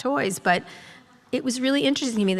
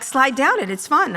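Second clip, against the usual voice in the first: higher, louder, chirpy coaxing; mock cheerful staff; 6 semitones higher, 5 dB louder than usual. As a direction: cheerily urge her down the slide. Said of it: "they're like".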